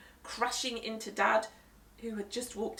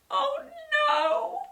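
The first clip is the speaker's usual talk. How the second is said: much higher wailing oh no; despairing mum; 8 dB louder than usual. Acted. Mum seeing ruined dinner, despairing wail.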